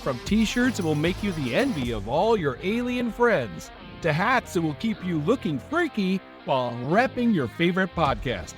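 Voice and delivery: ordinary delivery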